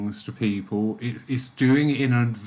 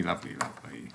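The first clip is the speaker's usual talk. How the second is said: ordinary talk, nothing marked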